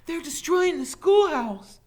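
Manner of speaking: very high, loud cry for help; breathless alarm. A narrator's reading. Teacher who ran to the sheriff, panicked. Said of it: "gasping for breath".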